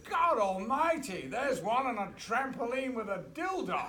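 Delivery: hammed-up scandalized luvvie; mock outrage. Actor appalled by naked extras, hammed-up loud high outburst. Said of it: "he goes".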